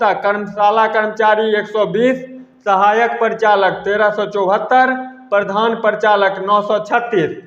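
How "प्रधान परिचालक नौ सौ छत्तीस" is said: normal talking voice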